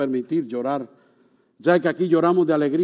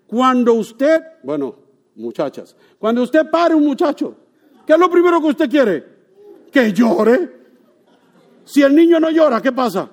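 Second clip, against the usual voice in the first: higher, louder preaching cadence; full-force preaching; 9 semitones higher, 8 dB louder than usual. Real, not acted. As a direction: preach it with rising fervour.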